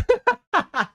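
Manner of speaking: high, helpless burst of laughter; high cackle. Real, not acted. Streamer cracking up at his own English, high cackling. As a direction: crack up completely.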